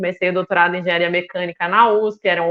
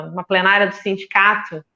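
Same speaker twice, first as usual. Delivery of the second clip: ordinary delivery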